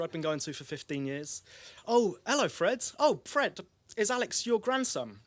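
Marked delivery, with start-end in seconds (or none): 1.9-5.2 high, louder greeting with swooping pitch; chirpy barber. Retold or imitated. Barber greets Fred and asks about his grandson, in a high, chirpy voice. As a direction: imitate a barber delightedly greeting a regular.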